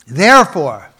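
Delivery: a preacher's emphatic Therefore. Real, not acted. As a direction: land hard on Therefore. Preacher announces chapter's key word.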